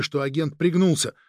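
ordinary delivery

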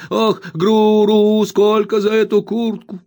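put-on high, slow, whining old miser's wail; spiteful whining old man. A narrator's reading. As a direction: whine it out spitefully like a crazed old junk dealer. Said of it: "произносил нараспев".

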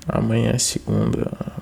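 ordinary talk, nothing marked

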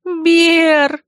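high panicked yelp; sharp, high cry of alarm; alarmed howl. A narrator's reading. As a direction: howl the name in earnest. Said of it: "взвыла я уже всерьёз".

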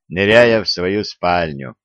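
usual speaking voice

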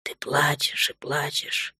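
slow teasing whisper. A narrator's reading. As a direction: whisper it tenderly into her ear. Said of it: "прошептал он ей на ухо".

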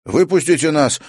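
high, loud shout. A narrator's reading. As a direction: yell to be let out. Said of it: "крикнул Тимофей".

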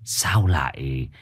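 put-on slow old man's questioning; drawn-out elderly voice; old shaman. A narrator's reading. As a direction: ask slowly, as an old shaman.

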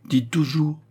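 neat, short imperative; crisp and brief. A narrator's reading. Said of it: "était nette et brève".

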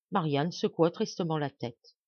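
usual speaking voice

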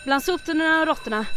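normal talking voice

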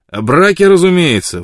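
loud, high blurt of the answer. A narrator's reading. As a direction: burst it out before he can stop. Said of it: "выпалил Мэтт".

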